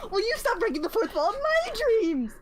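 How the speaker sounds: high-pitched mock indignation; indignant retort. Friend arguing over whose dream it is, indignant.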